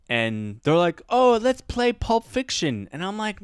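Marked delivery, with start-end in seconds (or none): 1.1-2.9 high, drawn-out mock student voice; eager student imitation. Students asking teacher for Pulp Fiction, mock eager voice. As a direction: imitate pupils pleading for a film.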